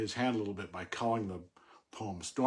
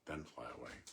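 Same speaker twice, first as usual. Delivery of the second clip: quiet requote; 9 semitones lower, 12 dB quieter than usual. Acted. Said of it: "So that's the last line".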